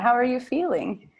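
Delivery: normal talking voice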